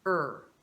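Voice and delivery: "er" as a single r-coloured vowel with falling pitch; isolated er sound. Shown as a demonstration. Teacher wrapping up the lesson's sound, a clear American "er". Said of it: "É o nosso som do".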